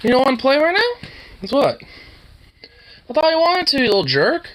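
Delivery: high-pitched mock scolding of a pet; high teasing pet talk. Real, not acted. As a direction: tease the puppy in a high sing-song. Teasing the dog about playing.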